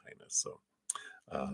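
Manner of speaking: ordinary talk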